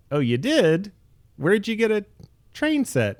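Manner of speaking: high, mock-innocent surprised dad; hammed-up fake surprise. Dad's fake interest at train set.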